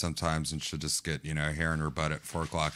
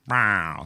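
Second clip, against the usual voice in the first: a light meow through the door; imitating cat meow; 3 semitones higher, 7 dB louder than usual. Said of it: "where I got that meow from".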